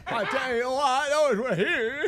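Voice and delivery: put-on botched British accent. Radio host botching London small talk for his co-hosts, mangled accent. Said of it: "that's a guy having a stroke".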